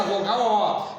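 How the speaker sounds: ordinary talk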